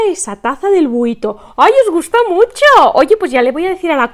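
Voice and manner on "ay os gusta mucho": loud, high, pleased cry; gushing, high-pitched pleasure; delighted exclamation. Real, not acted. Delighted reaction to a compliment.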